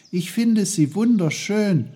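put-on high, loud, delighted child voice; admiring boy. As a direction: gush in a high boy's voice. Peter admires the trees' leaves.

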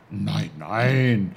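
loud, drawn-out character denial; emphatic put-on uncle voice; Onkel Pfefferkorn, protesting. A narrator's reading. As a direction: wave the idea away as the little uncle. Uncle hastily reassures.

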